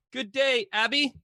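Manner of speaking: put-on high-pitched playful English accent greeting. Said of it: "I had a little English accent".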